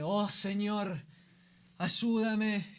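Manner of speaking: mock-dramatic plea, dragged out; overwrought prayer. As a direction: ham up a desperate prayer. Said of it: "decir cosas que... barbaridades".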